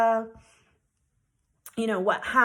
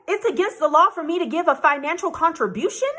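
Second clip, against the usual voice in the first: incredulous; 7 semitones higher, 6 dB louder than usual. Retold or imitated. Retold incredulous reply.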